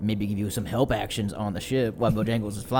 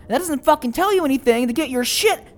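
high, loud angry retort; furious, forceful scolding; exasperated shout; 12 semitones higher, 9 dB louder than usual. Acted. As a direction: fire back and demand they pull it together. Emerald shouts back in argument.

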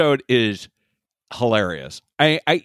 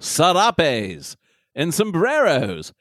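put-on British accent. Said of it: "some British douchebag".

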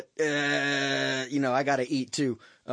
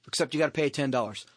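ordinary delivery

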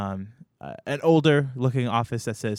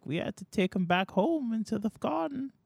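put-on high, soft, slow old man reminiscing; very old grandpa voice, wandering story; rambling ancient old man; 10 semitones higher, 4 dB quieter than usual. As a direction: drift off into an old memory, doddering.

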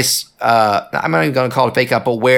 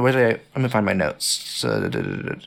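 muttering; 5 semitones lower, 6 dB quieter than usual. Real, not acted. Humming while searching notes.

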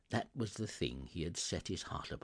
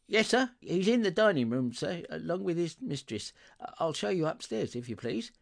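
high, loud, eager maid; servant girl; 8 semitones higher, 5 dB louder than usual. Acted. Servant girl showing Scrooge in, brightly obliging.

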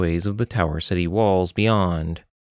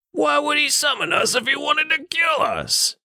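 high nasal-free gruff friend. Acted. Richter calls to Saladia from behind, high put-on voice. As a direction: call out from behind her as Richter.

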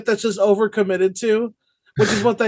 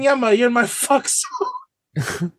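sleazy pickup voice; 3 semitones higher, slower than usual. Pretend. Acting out Lawson's pickup line.

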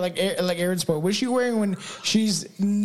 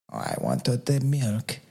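deep put-on fairy growl; milk-craving fairy; 8 semitones lower than usual. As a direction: drop far down into a mock creature craving. Voices milk-craving fairy as joke.

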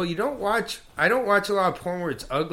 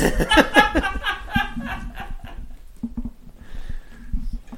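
high squealing laugh. Real, not acted. Big laugh at joke.

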